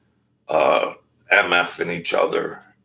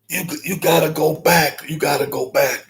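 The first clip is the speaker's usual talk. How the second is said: urgent insisting; 8 semitones higher than usual. Retold or imitated. Retold urging by an agitated man.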